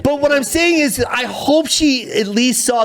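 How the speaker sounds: high-pitched, amused backpedalling; flustered protest. Real, not acted. Comedian defending his story's bad ending, flustered. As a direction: push the point through the laughter, voice high.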